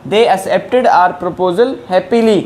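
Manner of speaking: reads the English example very slowly; slow dictation. Shown as a demonstration. Teacher to Hindi-speaking learners, slow dictation pace. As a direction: spell out each word for the students.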